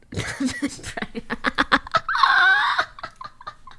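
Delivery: long high laughing fit; helpless high-pitched laugh; laughter. Real, not acted. Cracks up at own slip.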